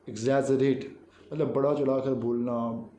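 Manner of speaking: ordinary talk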